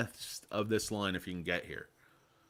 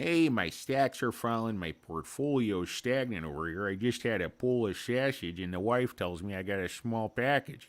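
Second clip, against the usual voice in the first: lightly put-on Polish accent; a hammed-up Polish accent, cheerful complaint; 3 semitones higher than usual. Streamer mocks a Polish guy's portfolio woes, in a Polish accent. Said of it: "horrible impression might imply".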